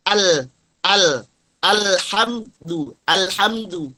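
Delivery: al and alhamdu with a light open A, separated for teaching; thin hamzah. Shown as a demonstration. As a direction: drill al, then alhamdu, keeping the A thin.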